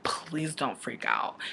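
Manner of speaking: slow, hushed plea to stay calm; soothing whisper. Retold or imitated. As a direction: whisper to keep her from panicking. Liam calming her down.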